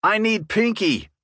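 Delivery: high, drawn-out holler; hollering for a weapon mid-firefight. Acted. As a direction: call it out over the gunfire, slowly. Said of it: "I hollered over the gunfire".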